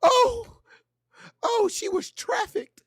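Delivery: high, gasping mock outrage over the gossip; mock gasping viewer. Mocking commenters who believe the story, high gasps. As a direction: squeal like a shocked gossip fan. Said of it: "mocking gullible viewers".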